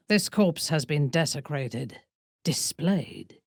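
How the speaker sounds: normal talking voice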